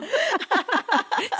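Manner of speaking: high burst of laughter. Real, not acted. Laughing at ghost voice joke.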